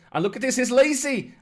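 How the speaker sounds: high, loud burst of commentary; excited shout. Real, not acted. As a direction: call out the sudden chance, pitched right up. Sudden scoring chance in commentary.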